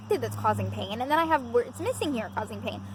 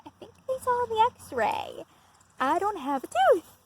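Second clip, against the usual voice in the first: worried aside; 8 semitones higher, slower than usual. Retold or imitated. Leans over to husband.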